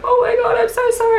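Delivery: high, loud, upset cry of apology; distraught apology. Retold or imitated. Crashed driver apologizing to staff, high and distraught. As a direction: voice the shaken driver near tears, high-pitched.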